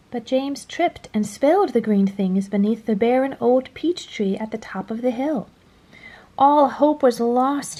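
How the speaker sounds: ordinary delivery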